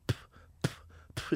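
dry little mock spit sounds; fake spitting. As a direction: pretend to spit, all air. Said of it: "I'm, like, faking. I'm going".

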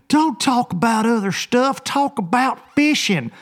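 high mock whine of an impatient fan; lightly voiced nagging viewer. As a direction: play a pushy fan demanding fishing talk.

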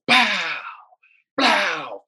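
high, drawn-out imitated blasts; imitated bat cracks. Pitcher retelling homers off his pitches, imitated blasts. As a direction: punch out each hit as a noise. Imitates home runs being launched.

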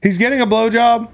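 high disbelieving question; incredulous. Real, not acted. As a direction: raise the pitch in shock.

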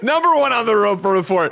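high, loud show announcement; segment announcer. Acted. Announcing the first news item.

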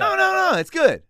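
"good" pushed high and loud; high insistent "good". Shown as a demonstration. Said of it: "different variations of tonal".

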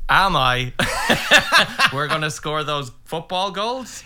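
loud, hammed-up eagerness, laughing; mock football enthusiasm. Teacher asks about next weekend, mock football enthusiasm. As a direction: overdo the fake excitement, pitch jumping up.

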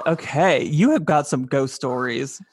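normal talking voice

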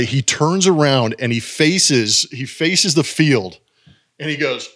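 ordinary delivery

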